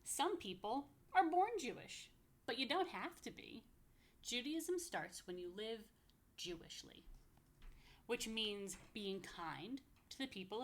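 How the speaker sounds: sing-song rhyming read-aloud, slow on the lists; lively picture-book reading; reading to children. Acted. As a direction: bounce the rhymes for little listeners.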